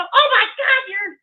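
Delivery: mock-hysterical gasp of outrage; mock outraged quote. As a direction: parody someone crying phobia over nothing. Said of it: "Pink Bow doesn't go".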